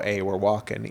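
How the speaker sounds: put-on low, soft take on a New York accent. Mocking New Yorkers who moved to New Jersey, in a New York accent. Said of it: "they don't wanna be so".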